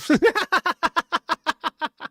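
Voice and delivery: high-pitched burst of laughter. Real, not acted. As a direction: break into a squeaky laugh. Laughs at own slip.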